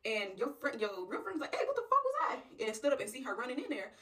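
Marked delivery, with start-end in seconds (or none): 1.5-2.6 very high, loud callout; acted alarm, pitch shooting up; startled demand. Real friend seeing her rush in, startled.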